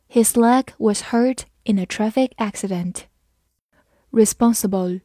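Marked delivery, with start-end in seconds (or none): none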